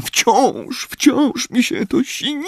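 high, slow sobbing voice; weeping. Acted. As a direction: cry it out brokenly. Molly still weeping.

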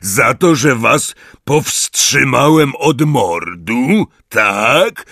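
loud, slow, indignant challenge; angry demand. Acted. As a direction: throw the accusation back at them. Hagrid confronts centaurs.

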